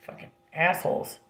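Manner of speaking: soft, breathy aside under the breath; a hushed, bitter under-the-breath curse; muttered curse. Real, not acted. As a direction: mutter the insult to yourself. Muttered insult after family story.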